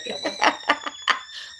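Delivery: loud high burst of laughter; big high laugh. Real, not acted. Laughs loudly.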